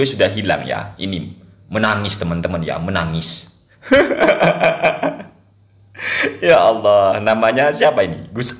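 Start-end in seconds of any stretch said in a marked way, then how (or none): 3.9-5.2 a real high-pitched burst of laughter. Real, not acted. Host laughing at the crying video title, high laugh.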